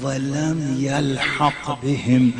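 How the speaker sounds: slow recitation. Retold or imitated. Quotes Arabic hadith slowly.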